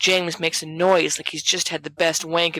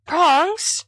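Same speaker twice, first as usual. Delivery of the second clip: higher, louder plea; appealing; 12 semitones higher, 4 dB louder than usual. A narrator's reading. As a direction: Peter begging James for support. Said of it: "Peter appeals".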